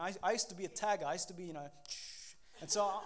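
ordinary talk, nothing marked

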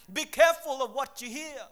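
loud, emphatic warning to the congregation; preacher's loud warning. Real, not acted. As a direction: press the warning home, loud. Preacher warning young people.

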